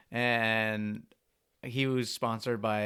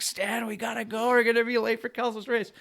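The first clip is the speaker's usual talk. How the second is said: urgent manager voice; 10 semitones higher, 3 dB louder than usual. Retold or imitated. Team manager at the door waking Stan for the race, pressing. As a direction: imitate the manager, higher and pressing.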